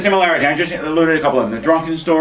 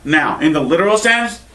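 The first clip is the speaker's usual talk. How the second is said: normal talking voice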